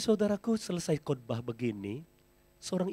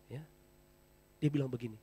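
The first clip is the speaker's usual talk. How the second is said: ordinary delivery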